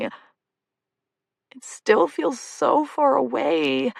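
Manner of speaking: whispered, very slow, tearful; choked up. Acted. Jessie tells Lucy the wait feels endless, whispered and tearful. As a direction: push the words past the tears. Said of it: "unsuccessfully pushing away my emotions".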